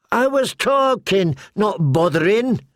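put-on high, slow indignant boy; Hot Pie answering Arya's threat, high and slow; protesting boy. A narrator's reading. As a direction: push it up high, hurt at the accusation.

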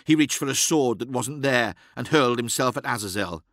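plain reading voice

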